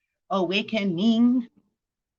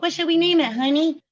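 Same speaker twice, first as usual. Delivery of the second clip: much higher, sweet mock question to a spouse; parent asking spouse; 9 semitones higher, faster than usual. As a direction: play a new parent asking their partner. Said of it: "it's like".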